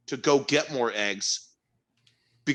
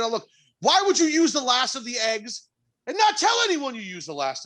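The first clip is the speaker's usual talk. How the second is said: exasperated; 11 semitones higher than usual. Real, not acted. Continuing exasperated rant.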